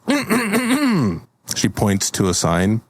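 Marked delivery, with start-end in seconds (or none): 0.1-1.3 high-pitched, disapproving "mm-mm-mm"; disapproving fairy hum. Pretend. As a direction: hum your disdain high, as a haughty fairy. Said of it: "she looks Gorp up and down, and she goes".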